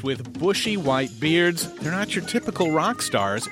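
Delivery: normal talking voice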